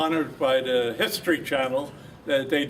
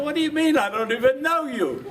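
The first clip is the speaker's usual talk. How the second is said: much higher, louder, laughing as he says it; baffled reply; 11 semitones higher, 4 dB louder than usual. Retold or imitated. Veteran retelling his confused reply to the stranger, high and laughing. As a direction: retell his puzzled answer, high and amused.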